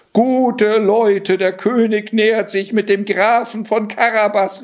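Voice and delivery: high-pitched herald's call. A narrator's reading. Cat warning villagers of the royal coach, calling out. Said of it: "rief er ihnen zu".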